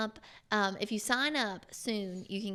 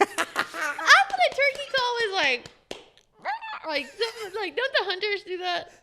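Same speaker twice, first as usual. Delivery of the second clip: laughing; 11 semitones higher, 7 dB louder than usual. Real, not acted. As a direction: talk while cracking up. Laughing, trying the call.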